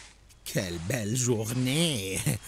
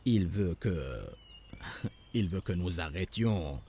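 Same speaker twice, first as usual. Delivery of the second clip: ordinary talk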